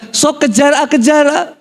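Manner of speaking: high mock mother's cry; urgent mother. Mother orders her son to chase the thief, urgent. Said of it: "Ibu saya bilang".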